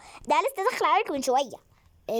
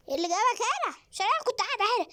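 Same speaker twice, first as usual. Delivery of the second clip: surprise; 10 semitones higher, faster than usual. Real, not acted. Surprised by baby in bed.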